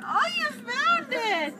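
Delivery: excited. Real, not acted. Excited praise to toddler.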